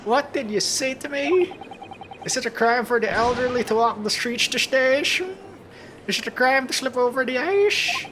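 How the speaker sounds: put-on indignant old British gentleman, pitched well up; huffy elderly Brit protesting his innocence; British accent. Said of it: "that's in a British accent".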